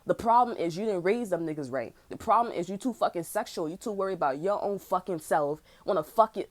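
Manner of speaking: normal talking voice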